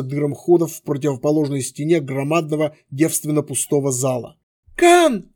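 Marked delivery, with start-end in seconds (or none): 4.8-5.3 high sudden call; cry out. A narrator's reading. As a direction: shout his name in alarm. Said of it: "едва успела вскрикнуть девушка".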